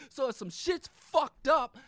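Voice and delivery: loud, slowed exasperated outburst; retold outburst. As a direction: shout it out like you've had enough. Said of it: "And I'm like".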